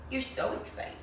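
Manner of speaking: whispered aside; excited whisper. Real, not acted. Reader whispering to the child about the dog, hushed. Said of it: "reader whispers to listener".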